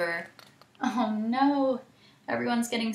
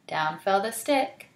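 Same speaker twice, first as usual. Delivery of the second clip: plain reading voice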